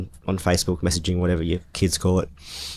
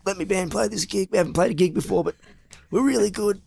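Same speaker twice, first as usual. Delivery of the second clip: high, wheedling con man voice; sneaky voice; 12 semitones higher than usual. Retold or imitated. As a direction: sweet-talk them in a high sly voice. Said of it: "that's my sneaky voice".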